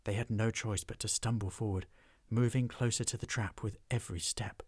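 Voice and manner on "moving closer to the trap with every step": ordinary delivery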